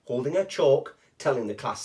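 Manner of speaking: usual speaking voice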